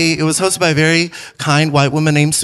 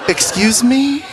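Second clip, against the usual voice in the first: mock shock; 8 semitones higher, slower than usual. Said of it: "I was like".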